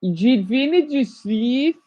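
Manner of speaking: slow, careful French; repeating French. Shown as a demonstration. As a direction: drag out the French words. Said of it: "repeating French aloud".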